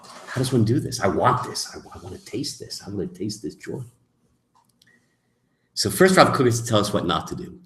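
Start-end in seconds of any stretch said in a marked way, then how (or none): 1.0-3.8 longing seeker. Pretend.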